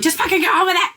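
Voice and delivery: imitating an exasperated friend yelling; impatient outburst. Friend mock-shouting at her to get on with it. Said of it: "So James is gonna be like".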